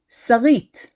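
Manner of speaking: Sarit as "sa-REET"; stress on last syllable. Shown as a demonstration. Teacher introducing the derived name, Sarit stressed as "sa-REET". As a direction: put the weight on the end of Sarit, "sa-REET". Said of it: "stressing the last syllable".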